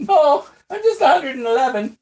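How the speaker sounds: high squeaky voice, laughing; squeaky old man. Acted. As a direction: insist in a squeak. Daryl insists on his age.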